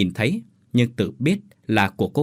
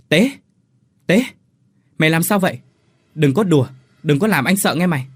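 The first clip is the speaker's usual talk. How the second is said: higher, louder cries to a brother; alarmed calling; 6 semitones higher, 5 dB louder, faster than usual. A narrator's reading. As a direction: call out urgently in alarm. Calls brother who started growling.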